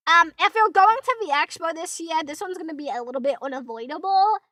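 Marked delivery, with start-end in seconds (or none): none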